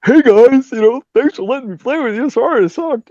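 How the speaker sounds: high, put-on hanger-on voice; mock yourself as a needy loser greeting the band; high-pitched self-mocking imitation; sheepish idiot. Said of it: "I'm gonna look like an idiot".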